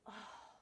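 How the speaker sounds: a sighed oh of relief. Acted. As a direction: let the oh out on a sigh. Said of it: "I sighed".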